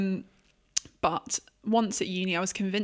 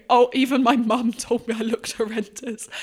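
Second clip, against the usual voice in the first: laughing; 4 semitones higher, 7 dB louder than usual. Real, not acted. Laughing about mum's verdict.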